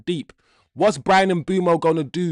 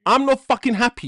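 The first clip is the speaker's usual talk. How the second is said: higher, a bit louder angry protest; heated insistence; 7 semitones higher, 4 dB louder than usual. Real, not acted. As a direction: insist you're not happy. Angry fan insisting after the goal.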